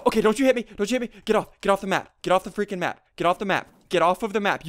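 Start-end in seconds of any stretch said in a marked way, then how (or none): none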